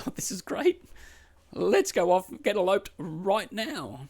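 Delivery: high, sarcastic fake delight; mock delighted fiancée. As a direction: play the thrilled bride as a joke. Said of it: "are you now going".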